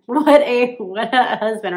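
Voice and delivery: loud laughing praise. Real, not acted. Wife praising husband who fished out her poop, laughing. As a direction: gush through a burst of laughter.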